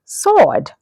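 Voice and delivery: "sword" as sord, no w; silent W. Shown as a demonstration. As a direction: say "sword" straight from s to o. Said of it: "So the letter W".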